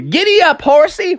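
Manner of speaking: high, lively call to a horse; giddy-up in a high cartoon voice; excited frog character. A narrator's reading. Froggy setting off on his covered wagon, high and eager.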